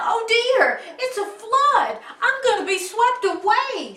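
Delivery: high, loud cry of alarm. Acted. Jubal seeing the flood around him, alarmed cry. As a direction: panic at rising water.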